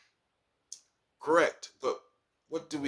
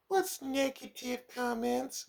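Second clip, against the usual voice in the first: mimicking girl; 11 semitones higher, 3 dB quieter, much slower than usual. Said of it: "girl's retort in dialogue".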